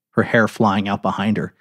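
usual speaking voice

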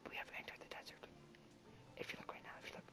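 barely-there whisper, no voice; secretive nature-film whisper; hushed documentary whisper. Pretend. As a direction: whisper it like a wildlife narrator. Mock wildlife documentary narration of the desert.

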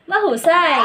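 loud, bright cheer of praise; excited praise. Real, not acted. Teacher cheering the kids after they recite, loud and excited. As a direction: shout it out like a proud teacher.

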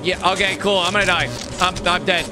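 ordinary talk

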